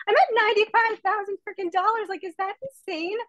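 can't believe it's real; excited disbelief. Real, not acted. Telling the webinar audience her earnings, thrilled and amazed.

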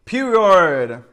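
high, loud exclamation; loud "period". Real, not acted. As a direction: belt out the word. Reacting to rating of twenty.

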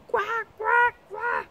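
croaking quoi quoi quoi; frog croaking. Acted. Frog croaking loudly after the princess. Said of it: "aussi fort qu'elle pouvait".

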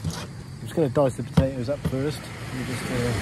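usual speaking voice

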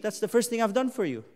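usual speaking voice

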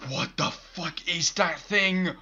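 loud, slow, freaked out; scared horror game streamer. Real, not acted. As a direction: drag it out in shock. Monster appears in game.

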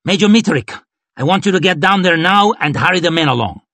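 high, brisk orders; commanding. A narrator's reading. Lord marshal orders major to hurry men, commanding. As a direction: issue orders fast and firm.

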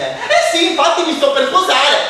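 high, loud giddy mother; excited mother. Acted. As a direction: squeal the news like a giddy mum. Mother voiced announcing wedding. (mamma euforica, acuta e forte)

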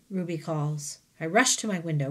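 usual speaking voice

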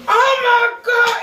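drawn-out high shout of real pain; slow, loud cry as the burn hits; anguished outburst. Real, not acted. Burn comes back full force.

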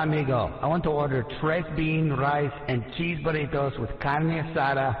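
ordinary talk, nothing marked

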